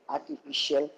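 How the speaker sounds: ordinary talk, nothing marked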